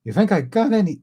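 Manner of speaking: high put-on girl voice; botched attempt at a little princess; Shuri. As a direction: pitch it up like a child. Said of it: "at doing Shuri's voice".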